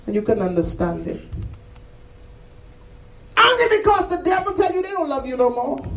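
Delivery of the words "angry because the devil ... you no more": high, raised shout; impassioned preaching cry. Acted. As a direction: cry out the refrain. Preacher cries out refrain of anger.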